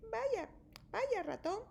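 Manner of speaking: high, light hare voice; a bright little hare greets her friend; hare greeting. A narrator's reading. Hare greets the mouse in the cave, high and light. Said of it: "dice Liebre".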